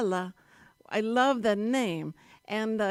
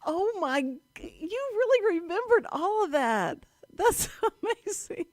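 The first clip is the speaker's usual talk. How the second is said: high, delighted gasp of amazement, laughing at the end; amazed; 10 semitones higher than usual. Real, not acted. Host amazed that guest remembered the theme song, high delighted surprise. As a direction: squeal with real surprise, pitched well up.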